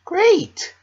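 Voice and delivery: very high, loud bubbly squeal; chipper Pinkie. Acted. Pinkie answering Missus Cake, high and bubbly. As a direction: bounce it up bright and sweet.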